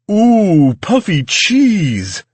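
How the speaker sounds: loud, high, drawn-out exclamation; delighted guest. A narrator's reading. Tall guest spots the cheese puffs, loud delighted squeal. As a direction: gush greedily over the food.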